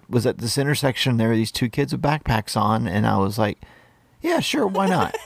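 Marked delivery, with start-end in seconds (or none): 4.2-5.2 light, carefree high voice; breezy mock agreement, pitched up; mock breezy yes. To the hitchhiking kids, mock-casual yes. Mocking own naive agreement.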